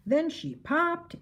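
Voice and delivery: plain reading voice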